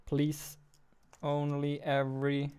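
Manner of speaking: ordinary talk, nothing marked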